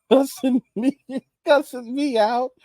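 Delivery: amused incredulous repeat; laughing disbelief. Real, not acted. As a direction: chuckle it out in disbelief. Laughing disbelief at being cussed out.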